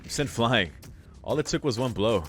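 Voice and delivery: ordinary talk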